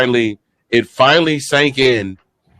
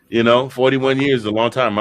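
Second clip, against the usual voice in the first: ordinary delivery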